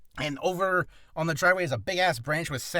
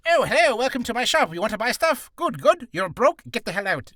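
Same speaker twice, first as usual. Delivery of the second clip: high, loud eager shopkeeper; hammed-up merchant patter; shop merchant; 6 semitones higher, 5 dB louder than usual. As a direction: greet customers big, then dismiss them. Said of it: "you know the shop merchant".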